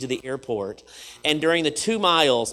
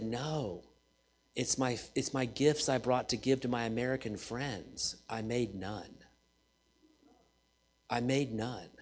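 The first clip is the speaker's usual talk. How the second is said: quiet, lowered, a touch of sorrow; soft sad retelling; 5 semitones lower, 11 dB quieter than usual. Retold or imitated. The student telling Spencer he kept no gifts, quiet and sad. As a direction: let it fall softly, almost apologetic.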